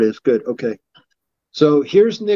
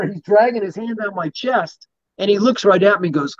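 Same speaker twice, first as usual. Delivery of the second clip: ordinary talk, nothing marked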